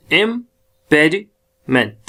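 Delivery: Impediment slow, with gaps between "im", "pedi", "ment"; syllable by syllable. Shown as a demonstration. Teacher modelling the word for learners, syllable by syllable. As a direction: break impediment into slow, separate chunks.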